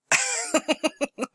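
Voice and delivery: a squeaky high laugh; high burst of laughter. Real, not acted. Laughs at own joke.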